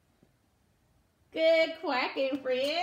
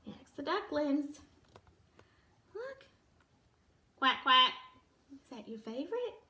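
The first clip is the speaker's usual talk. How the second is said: ordinary delivery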